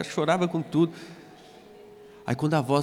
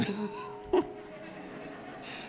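high breathy laugh; soft unvoiced chuckle; airy laugh; 8 semitones higher, 8 dB quieter than usual. Real, not acted. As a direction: let out a quiet airless laugh.

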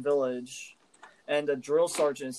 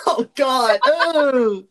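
loud laughing oh god; laughing groan; 10 semitones higher, 13 dB louder, slower than usual. Real, not acted. Laughing at gross story.

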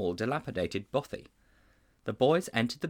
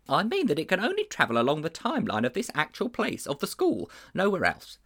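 a touch higher, earnest schoolmaster; lightly raised voice of a worried schoolmaster; lightly voiced teacher; 7 semitones higher than usual. A narrator's reading. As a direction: confide like a troubled old teacher. Teacher explains limit.

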